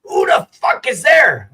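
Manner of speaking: slow, angry shout; retold drunk yell; shouted quote. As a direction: yell it at the door, slow and loud. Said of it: "She says".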